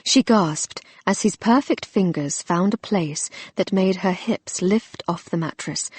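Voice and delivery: ordinary delivery, nothing marked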